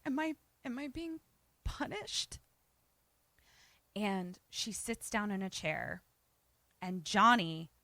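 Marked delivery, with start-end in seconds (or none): 0.0-2.4 tiny, high, very soft whimper; tearful question. Retold or imitated. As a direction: imitate a crying woman, halting and soft.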